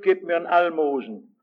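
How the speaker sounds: ordinary talk, nothing marked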